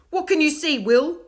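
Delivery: loud, high insistent question; demanding. A narrator's reading. Davina demands to know what Will sees, loud and high. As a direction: press him for an answer. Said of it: "demanded Davina".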